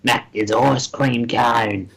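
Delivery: mumbled Australian cadger's patter; crusty Australian. Retold or imitated. Crusty bloke at local shops asks for cents, Australian. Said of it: "crusty Australian".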